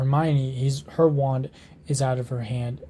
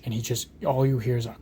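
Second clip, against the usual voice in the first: ordinary talk, nothing marked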